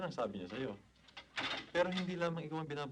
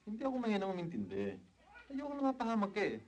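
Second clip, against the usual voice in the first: defensive protest; 9 semitones higher than usual. Acted. Defensive protest in an acted quarrel.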